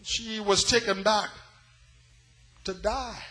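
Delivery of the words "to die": normal talking voice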